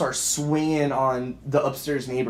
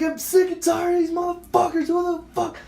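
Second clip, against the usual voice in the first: full-voiced re-enacted rage; retold screaming and yelling. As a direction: scream it out, sick of it all. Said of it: "Just screaming and yelling".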